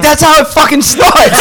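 high-pitched rant breaking into laughter; laughing mock rant. Friend mimics Frankie's rant, laughing through it. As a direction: play the outrage while cracking up.

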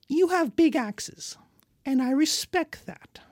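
high, slow, ingratiating lord voice; a nervous lord charming armed barbarians; smarmy local lord. Acted. Billy the lord greets barbarians.

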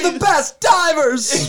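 high, slow hammed-up yell; boastful shout. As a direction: shout it like a cocky showman.

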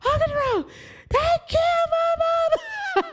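hysterical news. Retold or imitated. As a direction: wail it to your uncle half laughing. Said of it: "I called my Uncle Jerome".

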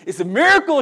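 high, loud burst of retold wonder; retold amazement. Paralegal reacting to a case returned in a week, retold amazement. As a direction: exclaim it like an astonished colleague. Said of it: "she said".

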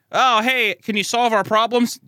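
high, loud, eager mock greeting; chirpy townsperson. Townspeople asking the hero for help, mock-chirpy. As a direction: pipe up like an over-eager villager.

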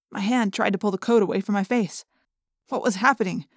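ordinary delivery, nothing marked

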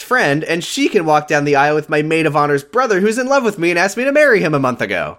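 high, rushing mock-bride chatter; mock eager planner. Podcast host mimics Adrian's convoluted wedding plan, mock eager. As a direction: pile up the absurd plan in her voice.

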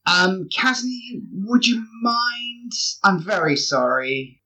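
high, slow, hesitant plea; sheepish request. Acted. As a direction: draw out an awkward favour. Asks companion to pay, embarrassed.

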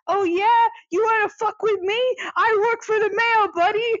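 put-on high, belligerent Boston accent; angry Boston guy. Boston guy pounding on a taxi, angry Boston accent. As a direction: imitate a Boston guy picking a fight. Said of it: "the most Boston guy ever is".

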